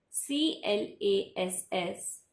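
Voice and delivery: each letter drawn out; spelling the word for pupils, slowly. Shown as a demonstration. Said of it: "spells letters before the word".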